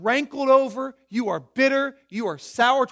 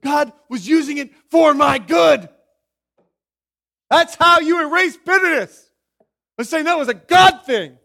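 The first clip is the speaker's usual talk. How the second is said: louder, rising preacher's cry; preaching shout; 5 semitones higher, 11 dB louder than usual. Real, not acted. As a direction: build to a full preaching shout. Preacher's climax on providence.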